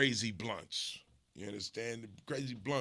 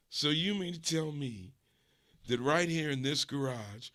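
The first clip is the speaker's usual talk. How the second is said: usual speaking voice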